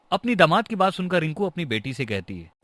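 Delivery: ordinary delivery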